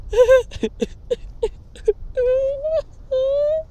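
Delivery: high-pitched mock bawling wail; imitated wailing cry. Teacher bursting into tears after her foot is crushed, high mock wail. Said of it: "and she goes".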